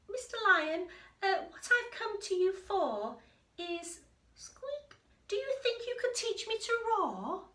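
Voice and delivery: high squeaky little mouse; a small creature daring to speak up; tiny mouse. Acted. Mouse asks the sleeping lion to teach him to roar, high squeaky voice.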